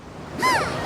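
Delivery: a sudden high gasp of realisation; sharp gasp. Acted. Paimon suddenly remembers it's filming time, sharp gasp. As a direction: gasp as if you just remembered.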